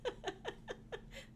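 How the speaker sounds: high giggling laugh; soft, high burst of giggles. Real, not acted. Amused after singing the puppy song, laughing.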